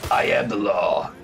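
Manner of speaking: low, slow mock shout of a stern lawman; Judge Dredd. Streamer quoting a movie hero after a kill, slow low mock shout. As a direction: growl it like a grim future cop. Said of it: "Judge Dredd check".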